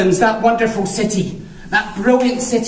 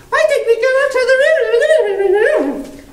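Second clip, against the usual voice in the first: put-on high, slow little boy voice; excited child; 3 dB louder than usual. Nephew suggesting the roof to his uncle, high voiced. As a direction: ask it like an eager small nephew.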